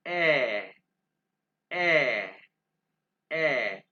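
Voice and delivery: loud, held "ए" as an open eh; German umlaut A. Shown as a demonstration. Teacher modelling A with two dots, repeated demonstration. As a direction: stretch "ए" into a falling ä. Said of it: "इसको कैसे pronounce करेंगे".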